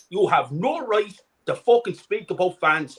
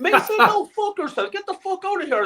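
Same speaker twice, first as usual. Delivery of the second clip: retold shout; 12 semitones higher than usual. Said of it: "I said".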